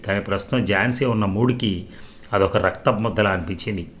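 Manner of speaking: usual speaking voice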